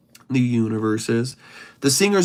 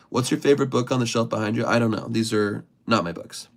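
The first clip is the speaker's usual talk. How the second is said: ordinary delivery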